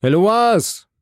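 high, loud, urgent cry of a name; repeated call. A narrator's reading. Husband repeats the call to his wife behind the door, calling out. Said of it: "wiederholte er".

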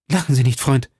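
urgent protest; mildly agitated plea; calling out. A narrator's reading. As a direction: call out to stop the laughter. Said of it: "rief D'Artagnan".

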